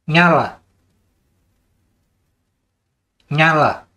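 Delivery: repeating the command Nyala to the module; steady command. Shown as a demonstration. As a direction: call the command the same way each time. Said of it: "intonasi harus benar-benar sama juga ya".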